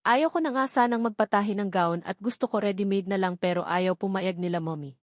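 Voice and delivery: ordinary delivery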